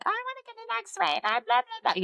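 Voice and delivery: high, whiny imitation of a pushy officer; mock their demanding voice, dismissive at the end; high put-on complainer voice trailing into blah blah. Mocking a demanding officer's request.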